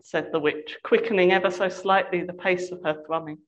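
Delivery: ordinary delivery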